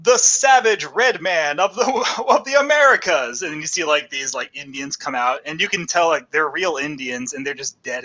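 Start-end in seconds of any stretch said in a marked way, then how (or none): 0.0-3.4 loud, high proclamation, slowing down; fairground show announcer. Acted. Barker presenting a show at the Paris Fair, grandly proclaiming. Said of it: "one of the shows is like".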